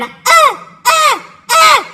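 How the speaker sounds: short, high yelps of pain; pained limping cries. Retold or imitated. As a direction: mimic yelping on each limping step. Imitates limping up the hill.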